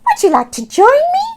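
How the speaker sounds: high, loud squeaky rat greeting. Acted. Robert the rat meeting Oscar, high squeaky hello.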